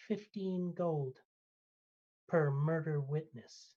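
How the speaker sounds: name the price slowly, each word distinct; clear as day. Acted. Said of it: "you hear him clear as day".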